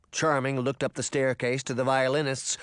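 plain reading voice

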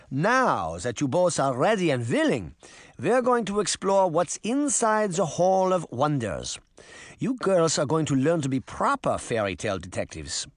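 put-on accented grandmother setting out the plan; Granny figure, encouraging. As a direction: voice an old woman instructing the girls. Voices Granny in the story.

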